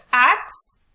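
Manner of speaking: AXE punched out loud; stress on AXE. Shown as a demonstration. Teacher testing option C with class, stressed. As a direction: hit "Axe" hard as a stressed syllable. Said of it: "we do need a higher pitch".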